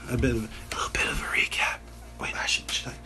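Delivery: breathy, hushed aside whisper; stage whispering. Pretend. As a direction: whisper it like a secret for the audience. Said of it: "They can hear you stage whispering".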